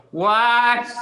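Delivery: loud, high yell back; imitating the kid's yell. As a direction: holler it back, mimicking a nagging kid. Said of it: "imitate him. You just go".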